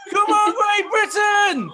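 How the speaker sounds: high, slow, stretched cheering yell; laughing roar from the stands; crowd shout. Retold or imitated. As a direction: call it out like a crowd urging a runner home. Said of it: "this is what they're shouting to me".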